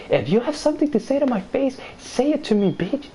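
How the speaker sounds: high, mocking imitation of her taunt; mock angry girl. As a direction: parody her squeaky challenge.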